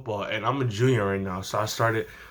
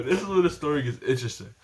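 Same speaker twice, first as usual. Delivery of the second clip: quick aside; faster than usual. Real, not acted. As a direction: rattle it off again. Quick repeat, softer.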